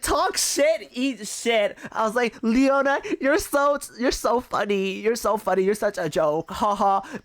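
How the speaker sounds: high, loud sing-song mockery rubbing the win in; mocking, gloating taunt. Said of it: "I was being so toxic".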